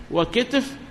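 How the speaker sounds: وكتف as "wa-kitf"; short i after k, no vowel after t in وكتف; second dialect form. Shown as a demonstration. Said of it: "ثلاث لغات في كتف".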